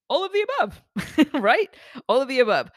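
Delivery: high, laughing "all of the above"; amused admission. Real, not acted. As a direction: own it with a laugh.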